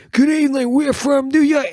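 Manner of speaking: high, loud mock punk frontman, New York accent; fake punk accent. Mocking American punk bands greeting the crowd in a New York accent. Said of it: "American bands ... it's like".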